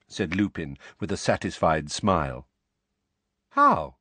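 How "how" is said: high, loud puzzled question; startled one-word query; perplexed. A narrator's reading. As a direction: ask it baffled. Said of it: "Harry asked, perplexed".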